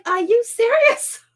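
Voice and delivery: high, slow disbelieving question; incredulous retort. Retold or imitated. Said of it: "And I was just like".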